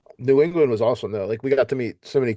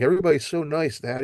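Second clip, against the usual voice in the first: higher, lightly voiced excited son; excited kid quote; 6 semitones higher than usual. Son telling his dad about the competition, mildly excited. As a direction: retell a child's delight, pitched up.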